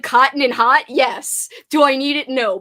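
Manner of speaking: ordinary talk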